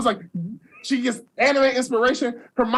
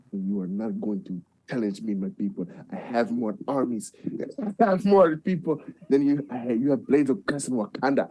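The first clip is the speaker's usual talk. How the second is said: much lower, softer menacing king; Namor voice; 11 semitones lower, 5 dB quieter than usual. Retold or imitated. Namor threatening the Wakandans, low and soft menace. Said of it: "He was like, he was like".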